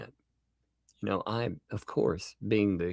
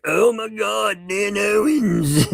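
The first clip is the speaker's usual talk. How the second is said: slow, much higher, gushing mimicry; mock gasp; 9 semitones higher, 4 dB louder, slower than usual. Mocking transcript readers, slow high gushing imitation. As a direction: drag it out like a starstruck fan. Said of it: "they're like".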